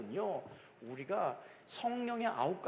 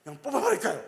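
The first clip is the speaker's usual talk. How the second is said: louder, eager servants asking to uproot; angry servants; 4 semitones higher, 8 dB louder than usual. Acted. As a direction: blurt it out like hot-headed servants. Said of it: "막 종들이 화가 났죠". (화가 나서 소리 높인 종들의 목소리)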